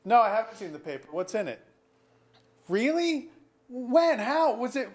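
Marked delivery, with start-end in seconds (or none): 2.7-4.9 high, quick stunned questions; startled disbelief at the news; shocked questions. Acted. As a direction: react as if you can't believe it.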